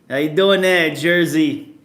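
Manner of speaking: put-on New Jersey accent. Streamer teasing viewers from Jersey with a New Jersey accent.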